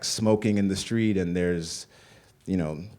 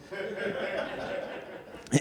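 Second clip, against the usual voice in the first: breathy laugh; 13 semitones higher, 7 dB quieter than usual. Real, not acted. Laughs at his own mom impression.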